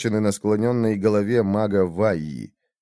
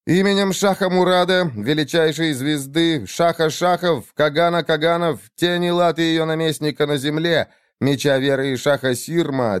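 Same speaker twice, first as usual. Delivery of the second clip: formal proclamation; 6 semitones higher than usual. A narrator's reading. Vizier reads formal charges.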